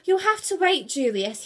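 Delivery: loud, irritated growl. A narrator's reading. Brutus refusing to look up from game, growled. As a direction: brush him off crossly. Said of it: "he growled".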